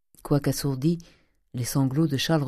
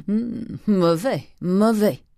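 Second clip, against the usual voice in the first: high, drawn-out grumbling disapproval; disapproving; 6 semitones higher, 4 dB louder, much slower than usual. Acted. Uncle disapproving of his nephew's fine ways, grumbling. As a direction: stretch the word out, pitched up. Oncle désapprouve les manières du neveu.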